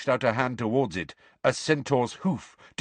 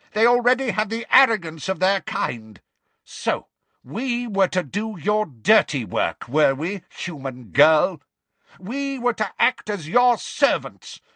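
raised-pitch, scornful outrage; indignant bellow; 8 semitones higher, 3 dB louder than usual. Acted. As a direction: rail at the human girl in offended pride. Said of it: "and bellowed".

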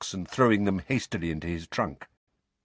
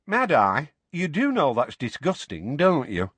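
put-on higher, chatty young witch; conversational; 6 semitones higher than usual. A narrator's reading. Young witch to old guard about his popped-out eye, conversational. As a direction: remark on it conversationally. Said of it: "said Tonks conversationally".

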